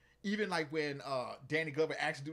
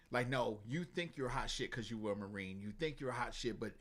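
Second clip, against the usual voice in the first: lower, softer put-down; low schooling tone; 8 semitones lower, 8 dB quieter than usual. Retold or imitated. Mentor telling the cocky Marine he's not hot shit, low. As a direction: talk him down quietly. Voicing Hal schooling John.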